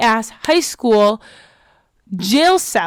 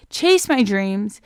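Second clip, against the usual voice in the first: ordinary talk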